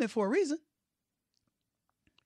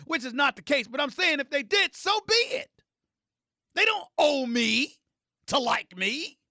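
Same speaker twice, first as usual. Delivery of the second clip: loud, high-pitched tirade; defiant outburst; 7 semitones higher, 6 dB louder than usual. Real, not acted. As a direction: raise your voice in real, heated defiance. Heated defiant rant at critics.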